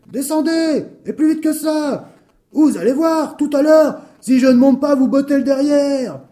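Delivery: high, loud barked threats; furious yelling. Acted. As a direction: bellow orders in a mad rage. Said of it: "hurla-t-il".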